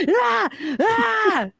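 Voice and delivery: high cartoon screams; high mock shriek.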